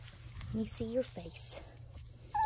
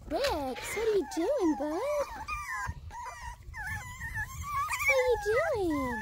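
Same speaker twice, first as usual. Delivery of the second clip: high, louder playful question to a puppy; 3 dB louder than usual. Real, not acted. Owner asking the puppy what he's doing, high baby talk. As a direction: tease the pup in a high voice.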